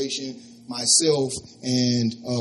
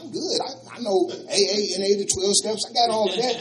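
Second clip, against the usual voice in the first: cocky protest; 6 semitones higher than usual. Retold or imitated. He argues back.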